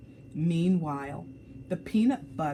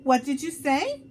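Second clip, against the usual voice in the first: taken aback; 8 semitones higher, 6 dB louder than usual. Acted. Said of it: "Salma asked, thinking she must have misunderstood".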